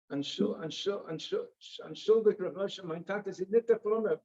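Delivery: stammered, halting start in Yiddish. Retold or imitated. Embarrassed nephew apologizing to the rabbi, halting Yiddish. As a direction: stumble over the first word, then get it out. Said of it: "he says with his halting Yiddish".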